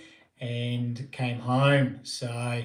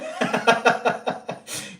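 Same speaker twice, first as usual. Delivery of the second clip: breathy laugh; 7 semitones higher than usual. Real, not acted. Laughs at his own request.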